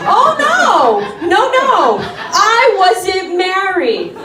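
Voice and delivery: ordinary talk, nothing marked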